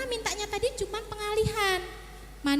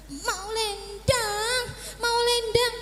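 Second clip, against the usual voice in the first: menirukan anak kecil merengek, setengah bernyanyi, lambat; rengekan anak; 4 semitones higher, much slower than usual. Retold or imitated. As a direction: rengek manja seperti balita minta digendong. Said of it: "kalau dia minta sesuatu, misal". (tiruan ringan anak merengek)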